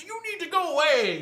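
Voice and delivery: acted frantic outburst, voice shooting high and loud; hammed-up hysterical ranting, stumbling over words. Old man loses it at the newcomer, hysterical. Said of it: "it really gets hysterical".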